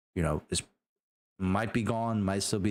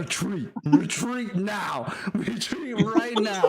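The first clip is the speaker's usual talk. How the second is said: high, laughing mock shout; hammed-up urgent command, drawn out slowly; shouted order; 11 semitones higher, 4 dB louder, slower than usual. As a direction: yell the order, cracking up at the end. Acts player's order to retreat.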